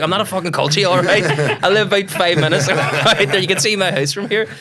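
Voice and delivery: loud, high mock-outraged retort; indignant comeback. Teller replays his retort to the doorman, indignant. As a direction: protest hotly, breaking into laughter.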